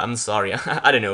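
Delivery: ordinary talk, nothing marked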